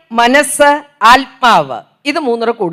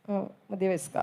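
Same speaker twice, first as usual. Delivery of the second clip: quiet self-satisfied repeat; 6 semitones lower, 17 dB quieter than usual. Shown as a demonstration. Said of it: "repeated to herself".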